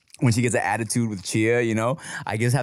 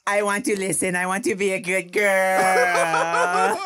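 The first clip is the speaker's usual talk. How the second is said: high, drawn-out mock bawling; imitating wailing child; 5 semitones higher, slower than usual. Daughter after mom yells at her, imitated long high wail. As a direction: promise it in a hammed-up toddler wail. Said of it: "After Chia, like, yells at her".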